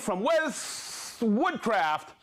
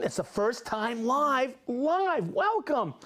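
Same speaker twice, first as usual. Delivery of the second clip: excited welcome; 4 semitones higher, slower than usual. Real, not acted. Host welcoming a first-time viewer: rising excited welcome. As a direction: pitch leaping up on the repeated live.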